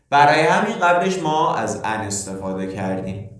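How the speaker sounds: ordinary delivery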